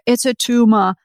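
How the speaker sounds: put-on joking Austrian action-hero accent; Arnold Schwarzenegger impression. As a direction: imitate an Austrian strongman, "tumor" said as "too-mah". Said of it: "talking like Arnold Schwarzenegger".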